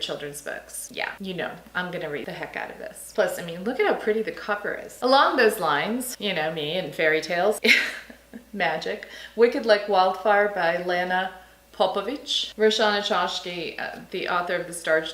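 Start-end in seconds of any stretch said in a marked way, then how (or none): none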